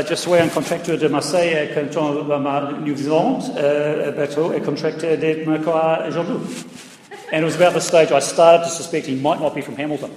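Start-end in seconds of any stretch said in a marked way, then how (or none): 0.0-6.4 French, a bit lower, fumbling for words; lightly voiced Frenchman answering in French; French boat builder. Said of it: "And he goes".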